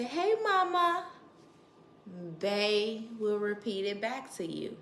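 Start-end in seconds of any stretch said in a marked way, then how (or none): 0.0-1.1 put-on high coaxing baby talk. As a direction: coax the baby to say hi.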